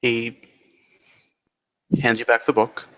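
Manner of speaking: normal talking voice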